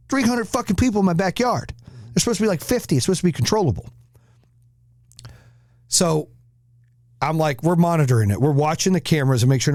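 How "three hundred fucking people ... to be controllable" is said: high-pitched retold panic; higher, exasperated mock freak-out. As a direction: recount it like an overwhelmed dad. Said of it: "And I'm freaking out".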